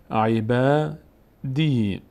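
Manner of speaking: عبادی slowly as e-baa-dii; long-vowel reading. Shown as a demonstration. Teacher reads the whole word, long vowels. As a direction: hold the aa and ee of عبادی. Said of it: "اینجا صدای کشیدهٔ".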